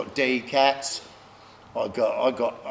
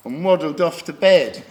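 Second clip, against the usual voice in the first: normal talking voice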